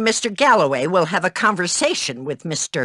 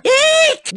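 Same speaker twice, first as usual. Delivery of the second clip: startled shriek; 5 dB louder than usual. Acted. Startled shriek in game bark.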